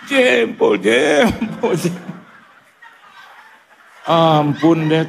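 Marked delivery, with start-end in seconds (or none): 0.1-2.1 put-on high, pained old woman's cry. Old woman in the crowd yelling about her toe, shrill and high. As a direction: yelp like a granny whose toe got stepped on.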